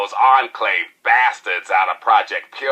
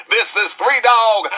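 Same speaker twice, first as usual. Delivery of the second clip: hyped DJ sign-off; 12 semitones higher than usual. Acted. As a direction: pump up the crowd with your own name. Radio DJ sign-on.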